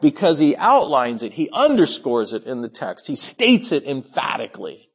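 ordinary talk

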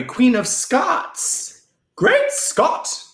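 high, drawn-out playful proclamation; hammed-up announcement. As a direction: proclaim it high and slow, hamming it up. Hammed-up announcement of Scottish character.

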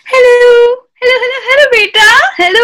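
high, loud mocking auntie greeting; pushy matchmaker auntie. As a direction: shriek hello like a kitty party auntie. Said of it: "You sound like an auntie at a kitty party".

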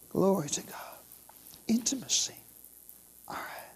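a hushed, reverent whisper. Real, not acted. Preacher whispering intimacy to the church, reverent whisper. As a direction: breathe the word intimacy. Repeated word, plain then whispered.

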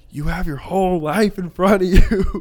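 high-pitched mock lecture to youngsters; exasperated pleading. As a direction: plead urgently, as if lecturing teenagers. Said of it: "I just wanna be like".

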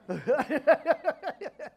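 loud, high burst of laughter. Real, not acted. Preacher who misheard the couple's names, laughing.

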